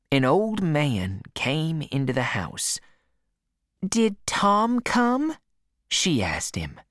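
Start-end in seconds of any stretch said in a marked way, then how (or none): 3.8-5.4 higher, slow innocent question; aunt's voice. A narrator's reading. Aunt pretending to her husband, higher and slow. Aunt Sally asks Silas.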